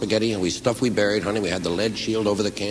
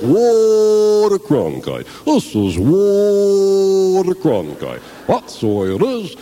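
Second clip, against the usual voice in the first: higher, louder, very slow anchorman drawl; Walter Cronkite impression; 9 semitones higher, 5 dB louder, much slower than usual. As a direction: sign off like a pompous TV newsman. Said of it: "That fucking Walter Cronkite".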